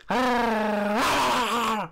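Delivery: high loud yelping dog bark; dog noise. Pretend. Streamer shows chat his dog noise, high yelp. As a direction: yip like a little dog. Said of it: "Or my dog noise".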